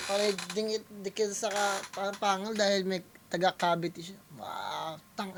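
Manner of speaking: usual speaking voice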